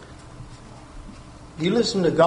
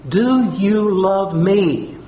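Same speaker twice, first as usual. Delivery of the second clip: very slow, weighty; slow question; much slower than usual. Shown as a demonstration. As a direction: let each word land slowly. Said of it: "preacher repeats slowly".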